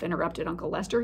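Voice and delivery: usual speaking voice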